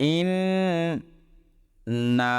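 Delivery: ইন্না sung high with the doubled nun drawn out. Shown as a demonstration. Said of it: "দুইবার নুনকে উচ্চারণ করলাম".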